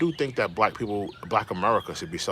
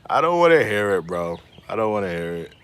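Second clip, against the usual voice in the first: louder, laughing through it; laughing dismissal; 4 dB louder than usual. Real, not acted. Streamer laughing off the complaints, loud. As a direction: brush it off while laughing.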